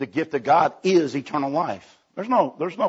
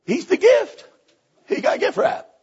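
high squeal. Real, not acted. Preacher hitting the gift-wrap punchline, high gleeful squeal. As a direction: jump way higher on the punchline.

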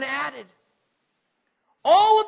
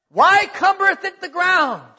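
loud proclamation; 6 semitones higher, 6 dB louder than usual. Acted. Owner's verdict quoted, loud and high.

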